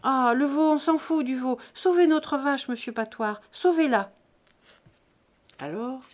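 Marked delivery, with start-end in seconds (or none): 0.0-4.1 higher, loud begging; frantic plea. Acted. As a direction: cry out the plea desperately. Farmers frantically begging the vet.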